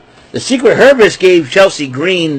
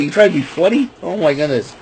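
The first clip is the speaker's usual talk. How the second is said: usual speaking voice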